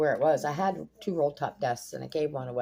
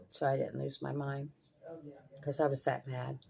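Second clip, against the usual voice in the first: normal talking voice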